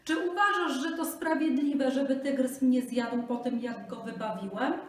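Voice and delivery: high, loud plea; pleading Brahmin. Acted. Brahmin pleads with the jackal, high and loud. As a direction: beg at full pace.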